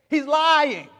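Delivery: high, pointed declaration; sharp, high call-out; emphatic verdict. Real, not acted. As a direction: nail it as the plain truth.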